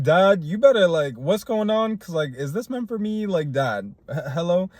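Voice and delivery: high, loud frantic call to Dad; mock alarm, voice jumping up and loud; panicked plea. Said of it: "feels scary or, like, about to go wrong".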